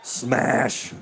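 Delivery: slow, strained monster-tantrum voice; hammed-up anguish of a stomping monster; raging Godzilla. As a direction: wail it like a frustrated movie monster. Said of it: "stomping around like Godzilla".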